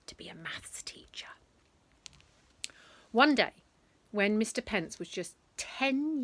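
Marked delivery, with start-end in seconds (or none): 0.1-1.3 whisper. A narrator's reading. Whispered ending.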